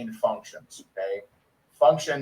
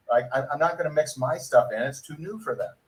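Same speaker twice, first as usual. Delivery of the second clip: ordinary talk, nothing marked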